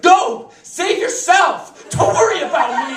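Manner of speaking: hammed-up action-hero yell, high and loud; heroic scream. Wounded husband screams to wife to flee, heroically. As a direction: scream it out, every command drawn out. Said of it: "and I scream to her".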